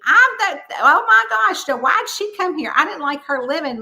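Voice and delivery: high, loud mock outrage; hammed-up offended Southern granny, settling as it goes; indignant ghost. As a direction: play her scandalized at an unwelcome guest. Voicing indignant ghost at her own funeral.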